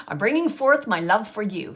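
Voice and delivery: a loud, heartfelt pledge; grand declaration. Retold or imitated. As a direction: declare it with ceremony. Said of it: "Like, the way he says it".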